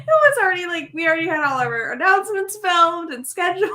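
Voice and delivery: high, laughing excitement; excited explaining. Real, not acted. Telling friends the plan was locked down, high and giddy. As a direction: gush about the secret plan.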